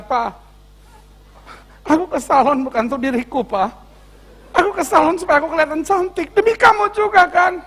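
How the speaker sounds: mock-hurt wife, pitch climbing high; hammed-up high wifely protest; offended, tearful wife. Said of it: "kalau udah tersinggung nangis".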